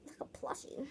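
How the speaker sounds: soft unvoiced whisper. Real, not acted. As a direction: whisper it to the plushie. Sudden whisper.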